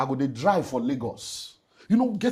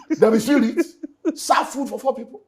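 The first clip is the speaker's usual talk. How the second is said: There be spirit laughed out, much higher and louder; laughing; 11 semitones higher, 10 dB louder than usual. Real, not acted. Telling the host about serving food for four, laughing through it. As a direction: laugh through the words at the absurd memory.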